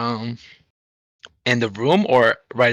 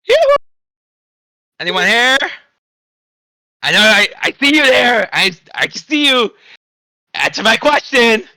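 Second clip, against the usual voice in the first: calling out; 12 semitones higher, 8 dB louder than usual. Acted. Calling to guards through door.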